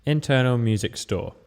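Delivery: ordinary talk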